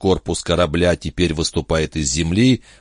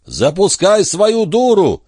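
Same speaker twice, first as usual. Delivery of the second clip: drawn-out, very high, loud yell; full-voiced, stretched-out shout; 13 semitones higher, 5 dB louder, slower than usual. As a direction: bellow the order slowly to someone far above. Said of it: "заорал он так".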